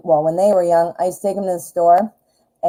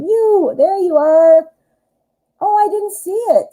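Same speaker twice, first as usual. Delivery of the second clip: found it; 12 semitones higher, 3 dB louder than usual. Real, not acted. Finding the donor's message.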